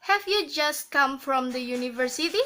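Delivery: "Have you just come from the university" with "university" turning up; rising intonation. Shown as a demonstration. Teacher repeating the question example, "university" rising at the end. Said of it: "rising intonation".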